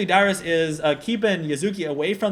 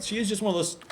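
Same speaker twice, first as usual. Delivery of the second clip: talking through hiccups; hiccup-broken delivery; 4 semitones higher, faster than usual. Real, not acted. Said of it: "I'm just hiccuping like crazy here".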